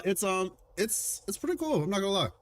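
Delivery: ordinary delivery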